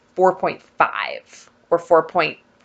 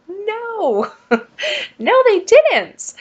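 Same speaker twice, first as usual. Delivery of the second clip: real laugh, much higher pitch; laughing disbelief; much slower than usual. Real, not acted. Laughing at the absurd news.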